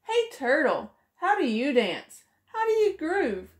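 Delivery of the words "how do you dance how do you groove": ordinary delivery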